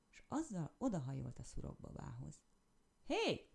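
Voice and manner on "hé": high, loud call; shout. A narrator's reading. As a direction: yell it to get attention. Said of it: "kiáltotta". (hangos, magas kiáltás)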